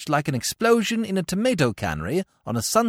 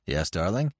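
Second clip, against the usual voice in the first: low, soft doting father; low fond father humouring his son; father's voice; 9 semitones lower, 5 dB quieter than usual. Acted. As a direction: coo it down low to the child. Father soothing his bratty son.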